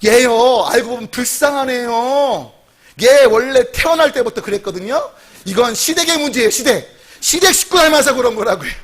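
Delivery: mock-affronted, with stretched key words; offended mother. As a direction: act out a mother's huffy blame-shifting. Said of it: "기분이 나쁘니까 이렇게 말해요".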